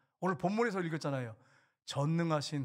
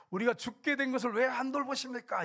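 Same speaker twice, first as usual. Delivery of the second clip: retold high-pitched protest; alarmed plea; 7 semitones higher, 3 dB louder than usual. Disciples waking Jesus in the storm, pleading in alarm. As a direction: plead urgently, pitch raised.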